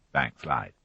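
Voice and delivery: ordinary delivery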